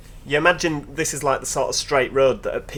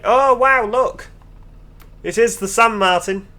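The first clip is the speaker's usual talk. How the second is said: high, loud burst of delighted surprise; real excitement; 10 semitones higher, 6 dB louder, slower than usual. Real, not acted. Player spotting the San Martin pub from the bus, loud and excited. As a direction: exclaim at a sudden spotting, pitched right up.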